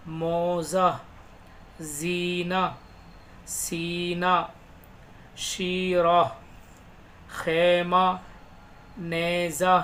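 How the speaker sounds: each word read out slowly and clearly, with pauses; teacher dictating vocabulary; slow word-list reading. Shown as a demonstration. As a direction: read the words one by one like a teacher.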